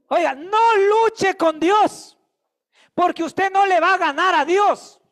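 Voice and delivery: rising preacher's passion; fiery preaching. Acted. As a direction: preach it hard to the congregation. Preaching at full force.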